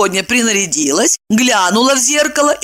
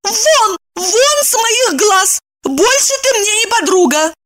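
high, indignant outburst; angry dismissal. A narrator's reading. Evdokia throws out her friend, high and angry. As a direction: banish the friend in a high, heated voice.

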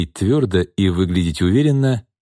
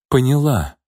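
usual speaking voice

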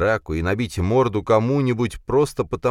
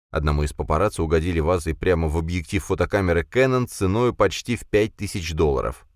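ordinary delivery, nothing marked

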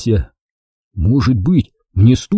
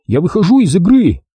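higher-pitched, mildly raised shout; loud call; 7 semitones higher than usual. A narrator's reading. Jack tells Wilson he quits the game, raised call. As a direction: call it out firmly. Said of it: "громко крикнул я".

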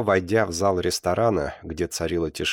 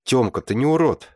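higher, louder burst of objection; indignant protest; 7 semitones higher, 5 dB louder than usual. A narrator's reading. Alyonka objects as Tyoma calls himself a freak, indignant protest. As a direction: flare up in loyal protest. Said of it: "вскинулась Алёнка".

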